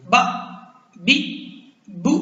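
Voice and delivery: "बा", "बी", "बू" each short and cut off sharply; with a jolt. Shown as a demonstration. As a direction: jerk each of "बा", "बी", "बू" to an abrupt stop. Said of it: "झटके के साथ पढ़ेंगे".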